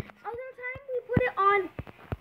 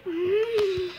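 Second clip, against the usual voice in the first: normal talking voice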